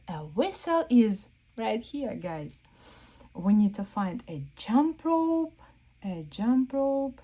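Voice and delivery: ordinary delivery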